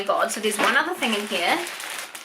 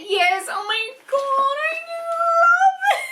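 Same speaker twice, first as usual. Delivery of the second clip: slow, much higher squeal of joy; delighted squeal; much slower than usual. Real, not acted. Opening Ali's second package, a delighted high squeal. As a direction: squeal it out like a thrilled fan.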